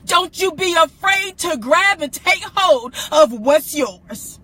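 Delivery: loud, building preaching cry; fervent voice breaking; preaching shout. Real, not acted. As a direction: shout it to the congregation.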